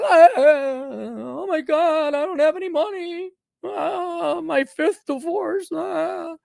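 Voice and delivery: high, whiny mock wail of a broke old boss; baby boomer boss impersonation.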